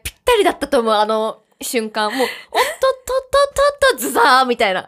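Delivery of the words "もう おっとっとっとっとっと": high, mock-alarmed "おっとっとっとっとっと" tottering; retold stagger done as a high comic sound effect; stumbling sound effect. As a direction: make the "おっとっとっとっとっと" sound like losing your footing. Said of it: "効果音がね、ぴったりだったと思う".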